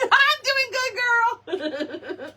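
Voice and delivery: high, slow laughing answer; giggling while calling back; laughing reply. Real, not acted. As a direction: laugh through the words.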